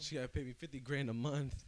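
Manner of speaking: loud, high excited demand. Pretend. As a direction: say it like you're claiming a prize. Joking demand for alimony.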